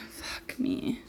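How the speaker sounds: hushed frustrated curse under breath; whispered curse. Real, not acted.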